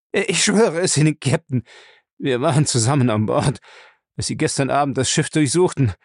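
frightened man's urgent confession; beaten captive, desperate to be believed. Acted. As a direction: insist anxiously that it is true.

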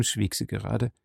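ordinary delivery

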